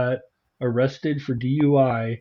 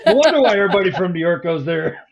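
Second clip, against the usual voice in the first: laughing; 4 semitones higher, 4 dB louder, faster than usual. Real, not acted. Laughing at New York joke.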